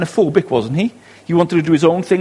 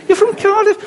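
much higher, louder, thrilled to find a fellow Welshman; excited surprise; 4 dB louder than usual. Retold or imitated. To a stranger on holiday, surprised, delighted and much higher. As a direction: squeal it like you just found a long-lost friend.

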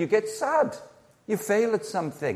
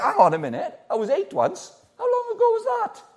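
amused disbelief. Retold or imitated. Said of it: "And you think".

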